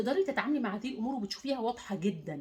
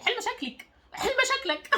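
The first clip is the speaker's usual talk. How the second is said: much higher, teasing command with a laugh; mock scolding catchphrase; 12 semitones higher than usual. Shown as a demonstration. Coach repeating her catchphrase to others, high and laughing. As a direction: toss it off like a playful scold. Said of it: "بقت الكلمه اللي على لساني".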